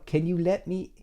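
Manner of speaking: high-pitched, halting begging; hammed-up desperate begging; mock pleading. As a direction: plead pitifully to be let in. Begging at a closed restaurant.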